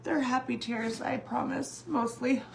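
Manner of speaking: high, breaking voice through real tears; crying while trying to talk through it; tearful crying. Real, not acted. Telling live viewers about her murdered loved one, crying. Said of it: "I don't know why I feel so emotional".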